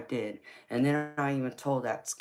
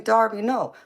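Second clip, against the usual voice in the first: louder, higher call to the dog; calling out sharply; 10 semitones higher, 10 dB louder than usual. Real, not acted. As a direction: snap a quick no at the pet. Calling out to a pet.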